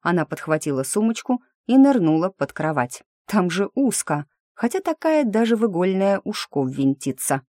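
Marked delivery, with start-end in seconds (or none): none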